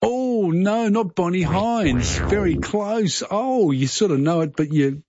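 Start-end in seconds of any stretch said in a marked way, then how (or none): 0.0-3.7 high, drawn-out commiseration over a near miss; oh, so close, stretched out high; sympathetic disappointment. Real, not acted. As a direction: let them down slowly, pitch lifted. Host consoles caller's near miss.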